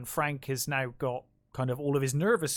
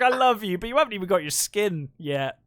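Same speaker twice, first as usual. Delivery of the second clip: higher and louder, mock-squeamish love line; mock disgusted affection; 6 semitones higher, 5 dB louder than usual. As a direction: gush at him while recoiling. Voicing Julia's disgust.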